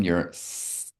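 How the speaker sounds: focused narrow S in "your s"; focused laser S. Shown as a demonstration. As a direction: aim "s" in a thin stream. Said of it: "with a laser beam".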